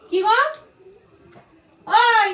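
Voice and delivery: loud call across the room. Acted. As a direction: shout to get his attention. Calling out to husband.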